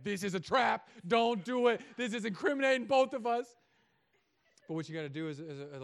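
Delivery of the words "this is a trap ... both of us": loud, higher mock alarm; sibling shouting a warning to the other; panicked sibling warning. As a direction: warn the sibling not to confess. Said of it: "the other sibling".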